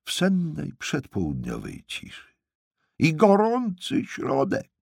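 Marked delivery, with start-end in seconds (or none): 3.0-4.7 very high, raised cry; high-pitched acted yell; hollering old aunt. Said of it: "Darła się Ewi co sił w płucach".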